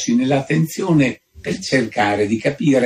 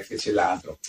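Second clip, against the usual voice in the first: usual speaking voice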